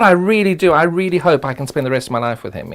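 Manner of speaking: usual speaking voice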